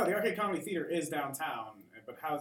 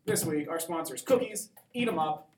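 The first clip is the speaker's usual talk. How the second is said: ad read; 3 semitones higher, 6 dB louder than usual. Pretend. Mock sponsor read with music.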